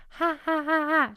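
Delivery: teacher shows a disengaged head-voice ha, high. Shown as a demonstration. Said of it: "no support used in it".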